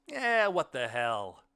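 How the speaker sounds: loud, drawn-out high "what the hell"; a long, loud shrug of a line; shrugging acceptance. A narrator's reading. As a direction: let it out big and slow. Narrator voices own thought, drawn out.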